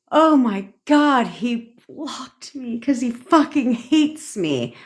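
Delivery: high, slow, dramatic wail of despair; mock panic. Girl realizing he blocked her, hammed-up high panic. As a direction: overplay the heartbroken panic.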